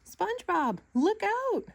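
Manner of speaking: high, loud shriek of warning; frantic high warning shriek. A narrator's reading. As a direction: shriek it out in alarm. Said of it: "shrieked Missus Puff".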